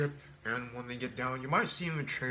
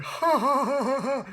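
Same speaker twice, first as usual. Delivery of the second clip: high, loud staccato ha-ha; mock booming laugh; 12 semitones higher, 9 dB louder, slower than usual. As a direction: laugh like a brute who finds it hilarious. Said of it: "He was like".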